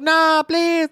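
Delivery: high, loud mock plea; hammed-up shriek of begging; panicked screaming. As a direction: wail it like a terrified coworker. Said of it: "[name]'s screaming".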